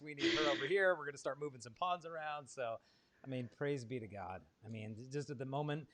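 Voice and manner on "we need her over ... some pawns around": loud, eager, louder boss voice; rally the saints to work; imagined Pope. Pretend. Host imagining the Pope giving orders in heaven, loud and eager. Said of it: "I could just imagine him".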